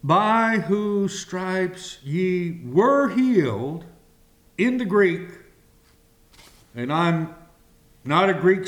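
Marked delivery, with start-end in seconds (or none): none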